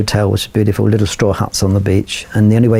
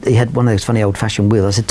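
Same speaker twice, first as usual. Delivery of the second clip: normal talking voice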